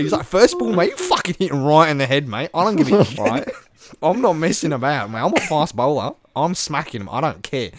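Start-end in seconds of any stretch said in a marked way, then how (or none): 0.3-3.2 loud, high mock Aussie; hammed-up, fired-up Aussie cricketer; Australian accent. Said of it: "everything you expect from an Aussie". 3.2-7.7 raised, defiant mock Aussie; hammed-up Aussie tough talk; Australian accent. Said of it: "everything you expect from an Aussie".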